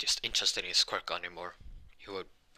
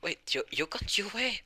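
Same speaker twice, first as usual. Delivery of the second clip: high-pitched, drawn-out astonished question; surprised question; 12 semitones higher, slower than usual. Acted. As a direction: act amazed, pitch leaping up. Acted surprise at the school uniforms.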